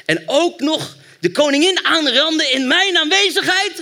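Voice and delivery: high, loud furious shout; furious king's outcry. Acted. Preacher voicing the king catching Haman with Esther, furious shout. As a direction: bellow it as an outraged king. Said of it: "riep de koning uit".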